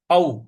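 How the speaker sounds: "औ" as a gliding a-u; Sanskrit au. Shown as a demonstration. Teacher shows the Sanskrit vowel, "औ" as a-u. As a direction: glide "औ" from a into u. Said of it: "Sanskrit में औ बोला जाता है".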